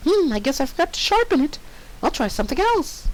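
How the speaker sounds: high, bubbly cartoon pony voice; squeaky pony girl; Pinkie Pie voice. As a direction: chirp it like a cheerful pony.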